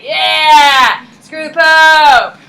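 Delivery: loud, slow cheering shout; crowd cheer. Retold or imitated. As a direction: roar it like a rebel crowd. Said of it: "the people are like".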